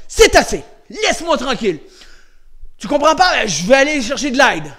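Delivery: loud, firm, commanding; authoritative tone. Shown as a demonstration. Showing a child how to tell off a bully, authoritative. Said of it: "T'as un ton autoritaire".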